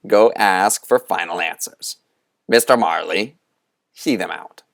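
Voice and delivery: hint of a commanding old spirit; Moundshroud's command. Moundshroud sends the boys off.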